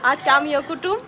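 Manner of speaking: usual speaking voice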